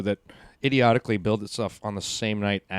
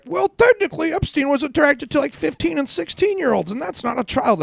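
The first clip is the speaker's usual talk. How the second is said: mocking a comedian. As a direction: parody a smug know-it-all. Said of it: "some comedian was like".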